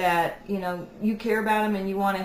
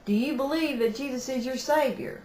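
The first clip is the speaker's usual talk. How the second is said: lightly imitated Southern accent, pitched up; 6 semitones higher than usual. Daughter voicing her Southern mother's question, light Southern accent. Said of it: "I got to do this in a true Southern accent".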